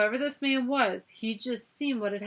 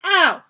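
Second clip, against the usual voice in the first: pained yelp; 9 semitones higher, 16 dB louder than usual. A narrator's reading. Kicked in the shin.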